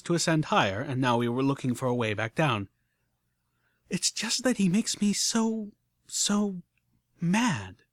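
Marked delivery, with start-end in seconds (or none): 3.9-7.8 frustrated outburst. A narrator's reading. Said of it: "burst out with a stomp".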